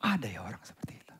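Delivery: low, fast, unvoiced whisper; awed whisper. Real, not acted. Preacher marvelling at such love, in a low whisper. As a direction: breathe it out in wonder. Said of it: "Saya bilang".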